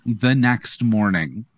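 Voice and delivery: normal talking voice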